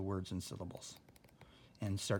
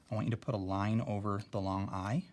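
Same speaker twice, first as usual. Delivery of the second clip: usual speaking voice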